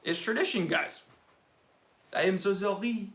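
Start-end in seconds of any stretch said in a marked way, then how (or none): 2.1-3.1 mock-French apology; French accent. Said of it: "I have to do the accent".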